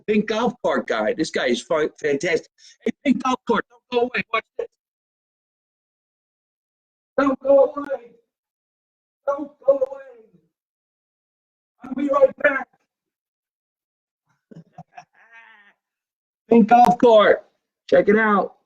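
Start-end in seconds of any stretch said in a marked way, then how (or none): 2.8-12.7 playful calls, higher and climbing very high; sing-song high calls climbing into falsetto; calling out. Acted. Host calling to the pink golf cart guy, teasing high calls. 16.5-18.5 louder, higher showy announcement; loud announcing. Acted. Host showing off the pink golf cart, loud announcement.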